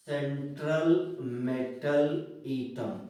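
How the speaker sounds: slow, stretched-out term, word by word; drawn-out teacher's dictation. Real, not acted.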